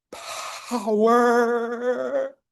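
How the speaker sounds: Power lifted high and held; high drawn-out Power. Shown as a demonstration. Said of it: "There's a power there".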